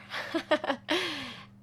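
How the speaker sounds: bright high giggle; a quick amused laugh; light laugh. Real, not acted. Laughs at own spelling.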